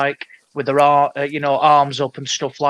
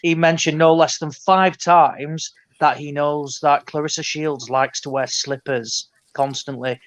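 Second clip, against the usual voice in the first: ordinary talk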